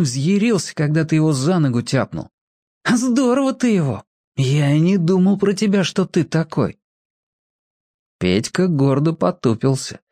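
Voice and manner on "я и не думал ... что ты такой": ordinary delivery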